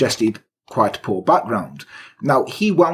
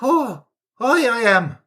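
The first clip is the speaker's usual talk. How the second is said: high, lilting stage-Irish voice; mock Irish; 7 semitones higher than usual. Lodger excuses the affair to the husband, mock Irish accent. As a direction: play a cheeky Irish lover in a high voice. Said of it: "'cause I'm Irish".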